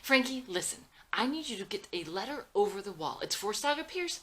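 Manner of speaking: loud, high, pressing instructions; insist, hurried and anxious; urgent and quick. Acted. Mother urgently instructing Frankie over the video call. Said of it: "Speaks quickly and urgently".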